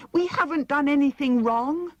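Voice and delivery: put-on high young girl protesting; a little girl's plea through the door; pleading girl. A narrator's reading. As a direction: insist on your innocence, high.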